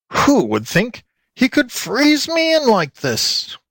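high, slow wailing fox; howling fox. A narrator's reading. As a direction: howl the complaint up high. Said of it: "howled the fox".

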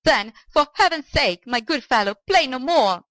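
acted shout of a distressed old man; shouted plea. A narrator's reading. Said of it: "shouted the old man".

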